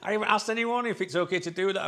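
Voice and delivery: giggling guilty admission; laughing. Real, not acted. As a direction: confess through a laugh.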